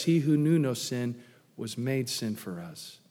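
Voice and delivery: ordinary talk, nothing marked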